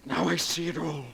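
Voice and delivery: ordinary delivery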